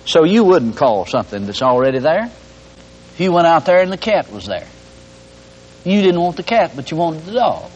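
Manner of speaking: usual speaking voice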